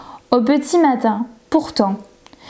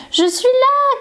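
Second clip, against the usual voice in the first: much higher happy call; crying out; 13 semitones higher, slower than usual. A narrator's reading. Girl bursts through the door to her parents, high happy call. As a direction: burst in announcing yourself. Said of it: "cria Charlotte".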